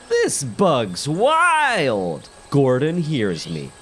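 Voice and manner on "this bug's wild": slow, drawn-out amazement; said out loud. Retold or imitated. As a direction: stretch it out in wonder. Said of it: "So I say out loud".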